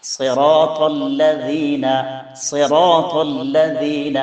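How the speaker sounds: സിറാത്തല്ലദീന with full, heavy emphatic sounds; slow sung സിറാത്തല്ലദീന, heavy emphatic letters; heavy tafkheem letters. Shown as a demonstration. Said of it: "തഫ്ഹീമോടുകൂടെ ഉച്ചരിക്കേണ്ട അക്ഷരങ്ങളാണ്".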